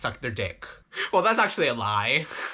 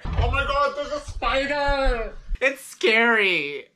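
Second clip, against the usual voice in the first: mock fright; 8 semitones higher, much slower than usual. Spotting a spider in the closet.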